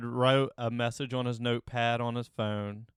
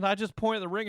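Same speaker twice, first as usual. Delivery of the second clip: normal talking voice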